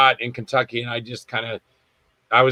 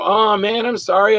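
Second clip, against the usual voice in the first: higher-pitched reenacted apology; pleading apology; 7 semitones higher than usual. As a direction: apologise as if just told off, voice lifted. Scolded actor apologises to director.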